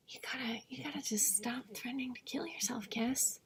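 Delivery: hushed whisper telling herself off; whispered self-scolding. Real, not acted. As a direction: whisper a firm reminder to yourself.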